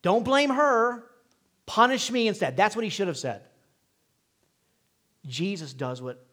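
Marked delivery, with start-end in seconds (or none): 0.0-2.5 taking the blame. Pretend. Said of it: "The first Adam should have done".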